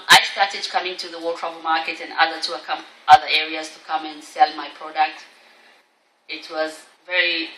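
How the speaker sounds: usual speaking voice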